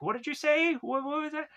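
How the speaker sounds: very high puzzled questioning; mock bewilderment. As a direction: squeak out a confused double-take. Emily startled by changed accent.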